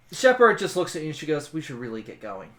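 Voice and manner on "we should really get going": quiet urging. Acted. Quiet word to companion.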